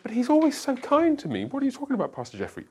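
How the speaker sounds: high-pitched mock little sister, protesting. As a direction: squeak it like an indignant little sister. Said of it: "your little sister is like".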